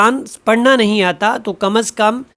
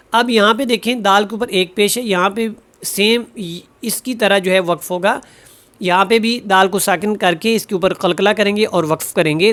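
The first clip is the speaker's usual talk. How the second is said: ordinary talk, nothing marked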